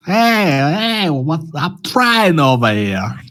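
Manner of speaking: hammed-up New York tough guy, "Hey, I'm trying over here"; New York accent. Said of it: "That's my New York accent".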